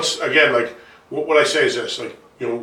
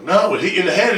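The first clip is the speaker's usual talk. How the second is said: retold protest; 6 semitones higher, 3 dB louder than usual. Acted protest to dad.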